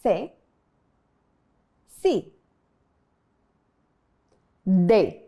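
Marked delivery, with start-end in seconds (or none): 0.0-2.3 "Se, si" with a soft s sound; soft Spanish C. Shown as a demonstration. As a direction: say "Se" then "si", s not k. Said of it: "Repeat after me".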